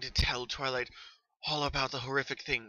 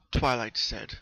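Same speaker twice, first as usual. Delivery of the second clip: ordinary delivery, nothing marked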